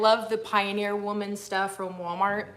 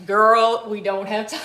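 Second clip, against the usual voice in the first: sassy brush-off; 7 dB louder than usual. Retold or imitated. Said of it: "And they were like".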